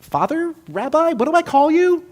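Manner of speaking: awkwardly groping for the right name; unsure car owner. Retold or imitated. Car owner addressing the minister, unsure and high. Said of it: "he says".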